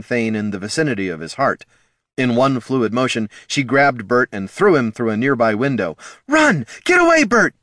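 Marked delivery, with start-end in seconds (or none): 6.3-7.5 much higher, loud frantic scream. A narrator's reading. Catha shouting Bert away from the troll. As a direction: scream at him to flee. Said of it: "she screamed".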